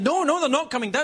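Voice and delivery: high, insistent mock argument; arguing father. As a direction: contradict the child, pitch climbing. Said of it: "We had our first argument".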